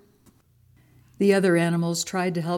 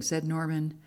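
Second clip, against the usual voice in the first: usual speaking voice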